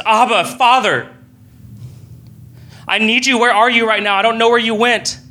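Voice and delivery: pleading high cry to Father; desperate scared child. Retold or imitated. Scared child crying out to God, desperate and high. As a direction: call out like a frightened child. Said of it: "cry out in desperation as scared children".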